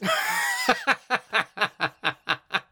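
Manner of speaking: very high, squealing laughter; real high laughter; long high laughing fit. Real, not acted. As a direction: keep cackling helplessly at the joke. Cracking up after the punchline.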